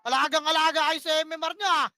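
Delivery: loud furious scolding at teammates; ranked teammates shouted at for playing safe; angry yelling. Real, not acted. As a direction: yell it at the team in real anger. Angry shotcaller scolding team.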